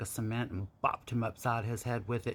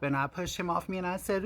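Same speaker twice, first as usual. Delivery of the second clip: normal talking voice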